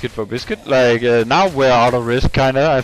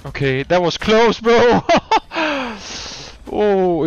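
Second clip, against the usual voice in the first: excited; 9 semitones higher, 3 dB louder, slower than usual. Real, not acted. Near death in game.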